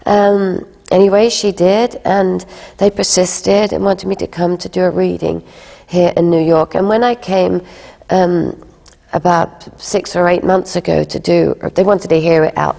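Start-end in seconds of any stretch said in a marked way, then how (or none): none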